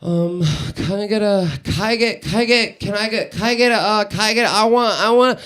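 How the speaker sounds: put-on halting, stuck-on-repeat kid voice; little boy ordering. Little boy ordering by himself for the first time, halting and stuck. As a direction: stammer through it like a child losing his nerve. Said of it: "he says this. He goes".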